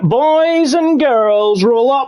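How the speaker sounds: ringmaster style roll-up call; big showman welcome to viewers; showman intro. Acted. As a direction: bark it like a fairground showman. Showman opening a race stream.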